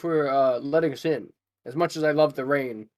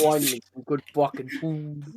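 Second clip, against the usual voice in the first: Gordon Ramsay. Retold or imitated. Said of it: "the Gordon Ramsay".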